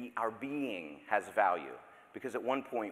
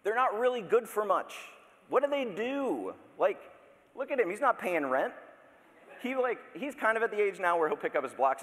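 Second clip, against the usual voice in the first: much higher, louder mock outrage; mock indignation; 11 semitones higher, 3 dB louder than usual. Pastor teasing the congregation about babies, mock outrage. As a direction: play up the outrage, tongue in cheek.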